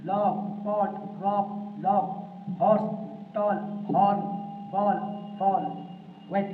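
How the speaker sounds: teacher reading a word list aloud for pupils to repeat. Shown as a demonstration. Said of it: "इन शब्द को जोर से बोलना है".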